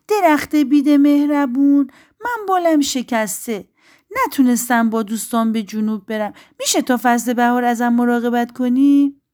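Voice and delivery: high, loud little-bird voice; pleading little bird. Acted. Injured bird begs the willow for care, pleading.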